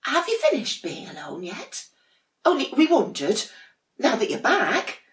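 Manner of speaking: very high, sing-song little voices; sweet sibling penguins. Acted. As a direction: coo it like cuddly little penguins. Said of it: "said a penguin".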